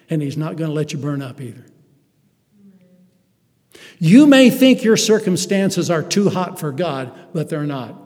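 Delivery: ordinary talk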